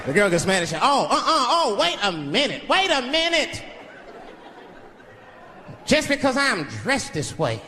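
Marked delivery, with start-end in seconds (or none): 0.8-3.6 higher, offended woman's voice, slowing at the end; indignant woman. Retold or imitated. Girl at the bar offended by a catcall, higher indignant protest. 5.9-7.6 put-on girl's protest original. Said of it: "I'm gonna be like".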